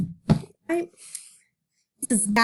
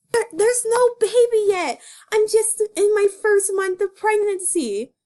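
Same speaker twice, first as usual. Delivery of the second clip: Judy voice; 8 semitones higher, 4 dB louder than usual. Acted. Judy voice in comic dub.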